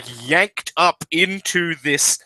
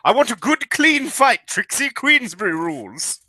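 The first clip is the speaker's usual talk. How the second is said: British boxer laying down rules, pitched up; big British guy; 4 semitones higher than usual. Acted. British mech pilot sets fight rules, sporting British accent. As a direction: announce the bout in a British accent. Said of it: "it's a big British guy".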